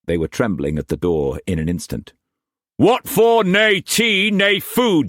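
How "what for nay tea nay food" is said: higher, louder raging master's voice; furious master. A narrator's reading. Struan raging at Mei Mei before whipping her, furious shout. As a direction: bark orders like an enraged lord.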